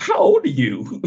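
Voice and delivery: drawn-out, incredulous question. Retold or imitated. Podcaster retelling his reaction to a young caller, incredulous. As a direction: stretch it out in mock disbelief.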